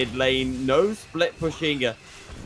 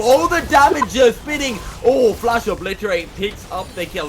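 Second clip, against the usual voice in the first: excited call; 8 semitones higher, 11 dB louder than usual. Real, not acted. Caster hype on a kill.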